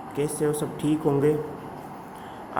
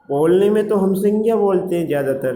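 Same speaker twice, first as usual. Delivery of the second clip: संज्ञा stressed, higher and louder; emphatic teaching; 5 semitones higher, 4 dB louder, faster than usual. Shown as a demonstration. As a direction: press hard on संज्ञा to drive the point home. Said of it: "लेकिन वास्तव में सही रूप". (ज़ोर देकर समझाते हुए)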